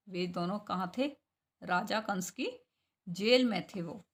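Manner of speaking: ordinary talk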